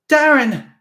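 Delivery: very loud high shout. A narrator's reading. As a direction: cry his name out. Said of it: "she shouted".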